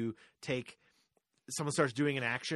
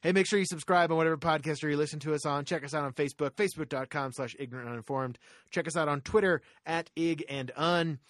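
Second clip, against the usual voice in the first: louder, higher announcer voice; podcast outro; 3 semitones higher, 6 dB louder than usual. Acted. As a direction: hype up the sign-off like a radio host. Hosting the outro.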